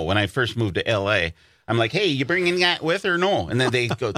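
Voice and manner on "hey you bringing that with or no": higher-pitched eager Wisconsin question; Wisconsin accent. Retold or imitated. Newcomer to L A asking friends, Wisconsin accent. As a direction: lay on a Wisconsin lilt. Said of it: "I have an accent".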